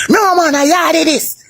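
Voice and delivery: high, dismissive Jamaican voice; mock Jamaican reply. A Jamaican rejecting him, high mock dismissal.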